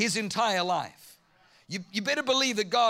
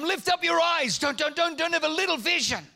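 raised voice, pressing the point; urgent exhortation; 8 semitones higher, 4 dB louder than usual. Acted. God urging Abraham, raised and pressing. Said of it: "He's saying to Abraham".

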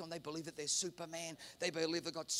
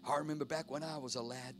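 put-on reminiscing old-timer; 3 semitones higher than usual. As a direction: put on an old-timer recalling bygone days. Voices old folks reminiscing.

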